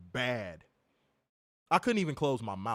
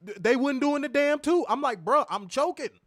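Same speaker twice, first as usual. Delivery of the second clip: high, loud and frantic; frantic retelling; 11 semitones higher, 6 dB louder than usual. Retold or imitated. Choking patient begging dentist staff, frantic high cry. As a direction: panic as the tube never comes.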